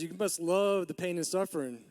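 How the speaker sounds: normal talking voice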